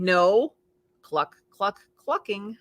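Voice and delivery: usual speaking voice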